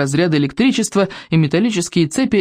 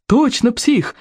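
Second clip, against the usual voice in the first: high-pitched cheering crowd; joyful approval; 8 semitones higher, much slower than usual. A narrator's reading. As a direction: cheer back gleefully, pitched up. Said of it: "захлопала толпа с радостным одобрением".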